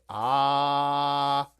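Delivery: long, held "ah"; drawn-out demonstration. Shown as a demonstration. Coaxing kitty to open up, long drawn-out "ah". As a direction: stretch "ah" like at the doctor's.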